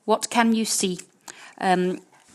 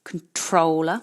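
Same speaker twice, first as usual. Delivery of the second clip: Controller with the stress on -trol-; stress on second syllable; 2 semitones lower, slower than usual. Shown as a demonstration. Teacher drilling Controller, stressing the second syllable. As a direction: lean on the middle of Controller. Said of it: "Notez bien l'emphasis".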